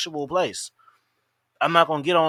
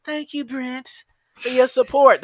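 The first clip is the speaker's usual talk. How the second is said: higher, sugary thank-you; hammed-up girly thanks, higher; mock sweet streamer; 6 semitones higher, 4 dB louder, slower than usual. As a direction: coo it like a streamer thanking a fan. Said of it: "she can be like".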